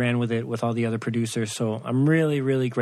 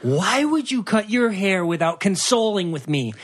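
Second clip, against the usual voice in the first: higher-pitched mock complaint; nagging partner impression, pitched up; imitating scolding fiancée; 5 semitones higher than usual. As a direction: put on her indignant, raised voice. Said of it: "she's like".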